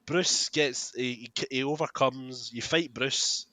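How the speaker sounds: ordinary talk, nothing marked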